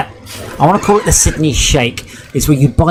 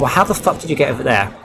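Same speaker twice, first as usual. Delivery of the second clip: ordinary delivery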